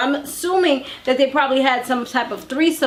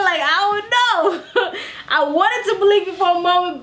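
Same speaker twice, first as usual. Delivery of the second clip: laughing; 7 semitones higher than usual. Real, not acted. Laughing at cast member's lies.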